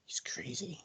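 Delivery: whispered under the breath; mumbled aside. Retold or imitated. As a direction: mutter it almost silently. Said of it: "he just mumbles".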